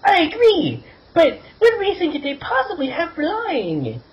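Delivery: put-on very high, squeaky girl voice. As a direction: pitch way up for a young girl. Girl character voiced by narrator.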